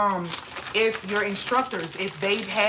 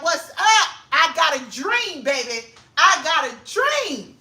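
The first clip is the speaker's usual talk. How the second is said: loud, high, slow sermon delivery; preacher-style orator; 7 semitones higher, 4 dB louder, slower than usual. Retold or imitated. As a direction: channel a civil rights speech. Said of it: "I stepped up in that thing like".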